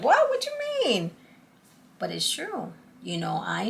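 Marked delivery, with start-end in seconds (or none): 0.0-1.1 very high squeaky mock disbelief; teen disbelief. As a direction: play her younger self, stunned. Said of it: "And I was like".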